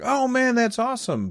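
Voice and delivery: high thrilled gush; excited. Retold or imitated. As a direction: gush like a fan hearing big news. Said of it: "which at first I was like".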